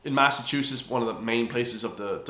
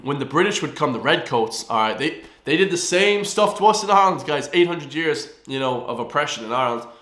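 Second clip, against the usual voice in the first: ordinary talk, nothing marked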